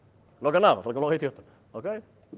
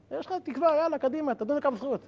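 much higher, rousing encouragement; encouraging rebbe; 10 semitones higher than usual. Retold or imitated. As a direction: lift him up, come on.

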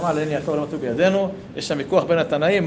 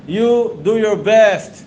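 slow English quote; 4 semitones higher, 7 dB louder, much slower than usual. Retold or imitated. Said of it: "במילים של סבתא שלי באנגלית".

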